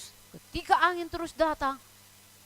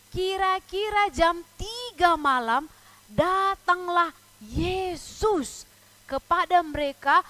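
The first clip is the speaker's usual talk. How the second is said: put-on higher, louder Bible reading for children; dramatic scripture reading; 4 semitones higher, 6 dB louder than usual. A narrator's reading. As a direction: read the verse out grandly, slowing at first. (membaca Alkitab dengan lantang untuk anak-anak)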